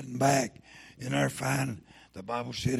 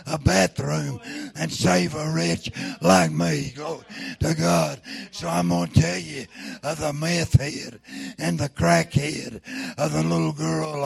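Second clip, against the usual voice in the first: loud revival preaching, every line pushed out hard; preacher rousing the congregation toward God, preaching loud; fiery preaching; 3 semitones higher, 6 dB louder than usual. Acted. Preacher calling sinners to repent, loud fiery preaching.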